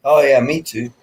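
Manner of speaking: ordinary talk, nothing marked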